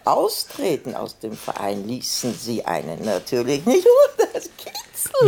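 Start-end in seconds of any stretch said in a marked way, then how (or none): 3.7-5.3 ticklish giggle. Acted. Old lady being tickled.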